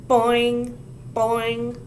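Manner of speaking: plain reading voice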